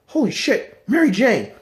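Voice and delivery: high-pitched shocked exclamation; acted alarm. Spider-Man seeing people disappeared, acted alarm. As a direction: cry out as dread suddenly hits.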